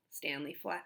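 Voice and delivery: usual speaking voice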